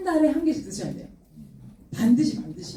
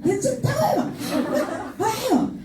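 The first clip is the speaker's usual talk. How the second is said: high, drawn-out exasperated protest; hammed-up squabbling; retold quarrel; 10 semitones higher, much slower than usual. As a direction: stretch it out high, as in a mock family fight. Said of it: "엄마랑 막 대판 싸우고".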